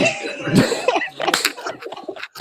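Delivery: helpless, squeaky laughter; high wheezing laugh. Real, not acted. Friend admits forgetting how to play Jeopardy, high helpless laugh. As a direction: crack up into a high, breathless wheeze.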